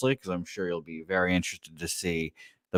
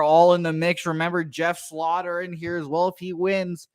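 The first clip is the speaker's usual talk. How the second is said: horse race call; 8 semitones higher than usual. Pretend. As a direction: launch the race like an excited track announcer.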